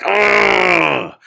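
loud, high straining growl; effortful roar. Acted. Cyclist lifting weights on his bike, loud straining roar. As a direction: roar as if heaving a barbell.

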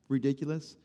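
ordinary talk

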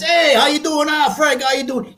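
friendly neighbor. Retold or imitated. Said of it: "he crossed the fence".

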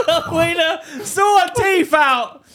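high, very drawn-out holler; mock calling out. Man joining friend's call about teeth, mock calling out. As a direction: call out slowly in a put-on voice.

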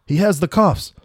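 high, loud alarm; panicked. A narrator's reading. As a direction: warn your partner and get out fast. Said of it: "Both of them left in a hurried panic".

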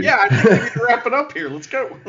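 put-on Southern accent, lightly done; a hint of a put-on American South accent; Master Wato Southern accent. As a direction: play a folksy Southern good-guy wrestler. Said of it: "he's putting on a voice".

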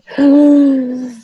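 a sudden panicked gasp in; sharp gasp. Retold or imitated. Mother hitting overload, sharp gasp. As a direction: gasp as if stress hits all at once. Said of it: "starting to feel myself getting".

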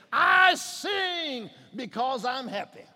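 loud, high, drawn-out proclamation; rising exultation. Acted. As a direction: belt out the hymn line slowly. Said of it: "the temperature of the song changes".

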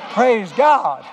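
loud, high shout of praise; jubilant praise. Real, not acted. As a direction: cry it out in celebration. Exclaims praise at story's turn.

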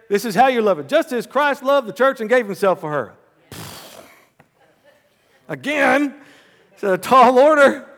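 high, loud preacher shout; preaching loudly. Acted. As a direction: shout the sermon to the crowd. Preaching at full force.

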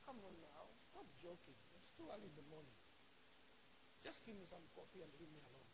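low, soft morning grumble; unimpressed, subdued dismissal; weary disbelief. Acted. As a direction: brush them off quietly. Acts weary, doubting Thomas.

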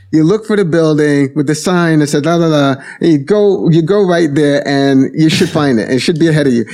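high, fast Brooklyn New York Italian voice; Brooklyn Italian guy. Retold or imitated. Brooklyn Italian truck guy giving a stranger directions, Brooklyn accent. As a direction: do the helpful Brooklyn Italian workman. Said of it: "very Brooklyn, New York, Italian guy".

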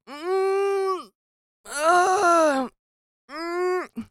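high, strained hums of panic; drawn-out high "mm" and "uh"; frozen stammering whimper. Retold or imitated. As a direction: whine wordlessly, stuck for an answer.

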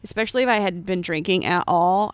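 usual speaking voice

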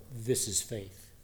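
faith, softly. Shown as a demonstration. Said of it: "You see the contrast?".

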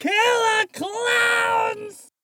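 high, slow anguished cry; wailing shout. Acted. Dean wails the name.

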